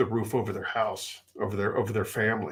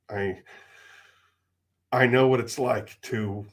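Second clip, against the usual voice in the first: emotional. Real, not acted. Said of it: "I apologize for getting emotional".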